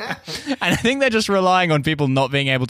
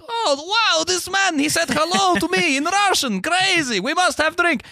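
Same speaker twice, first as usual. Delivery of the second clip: put-on much higher, amazed Russian accent; a gushing Russian host, thrilled by a hello; impressed Russian, heavy mock Russian accent. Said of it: "they're like".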